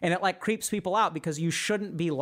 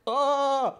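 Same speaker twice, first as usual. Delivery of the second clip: disgusted ugh; 8 semitones higher, 4 dB louder than usual. Retold or imitated. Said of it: "you're like".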